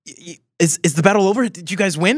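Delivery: put-on anxious young girl asking; young woman voice. Young Glenda asking the Tin Man about the battle, anxious. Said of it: "Glenda the third, the young woman, go".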